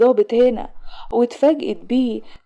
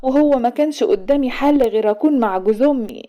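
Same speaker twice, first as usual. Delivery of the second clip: usual speaking voice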